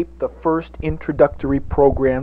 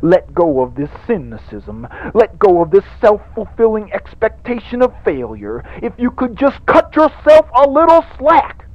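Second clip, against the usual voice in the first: preacher building up; 4 semitones higher than usual. Acted. Televangelist pleading with his radio flock, building to a frenzied peak. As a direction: build the plea until it bursts.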